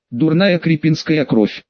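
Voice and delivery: usual speaking voice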